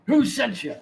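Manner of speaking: high, loud burst in character; sharp demand. Acted. Shopkeeper to visiting players, loud suspicious demand. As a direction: fire the question at them.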